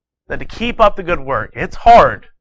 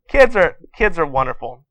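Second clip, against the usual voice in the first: ordinary delivery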